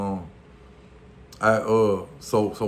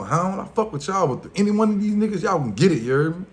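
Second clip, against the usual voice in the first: calling them out; 10 semitones higher than usual. Retold or imitated. Retelling calling out rivals on the porch, raised high challenge. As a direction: call out the whole crew, higher and fast.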